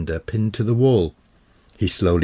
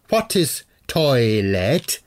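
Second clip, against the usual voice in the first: put-on slow, puzzled old warlock voice; 4 semitones higher, much slower than usual. Warlock confused by the word toilet, slow and baffled. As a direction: ask it slowly, baffled.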